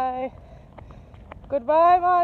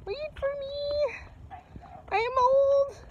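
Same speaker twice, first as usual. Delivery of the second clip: voicing the dog Jack; 9 semitones higher than usual. Owner voicing her dog Jack begging to wait, whiny high voice. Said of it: "Jack says".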